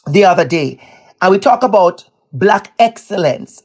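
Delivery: ordinary talk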